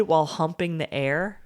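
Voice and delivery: usual speaking voice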